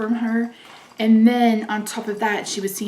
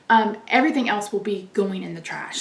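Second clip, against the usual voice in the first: usual speaking voice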